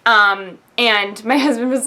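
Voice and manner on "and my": ordinary delivery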